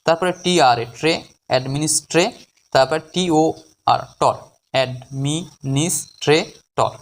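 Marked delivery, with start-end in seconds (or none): 4.7-6.9 very slow, five separated syllables; drawn-out teaching breakdown of the word. Shown as a demonstration. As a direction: leave a gap after each syllable. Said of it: "পাঁচটি সিলেবালে ভাঙতে পারবো".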